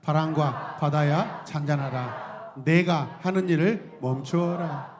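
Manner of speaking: slow, measured recitation; call-and-response reading; slow group recitation. Shown as a demonstration. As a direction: lead the congregation, slowly. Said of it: "함께 해보세요. 시작".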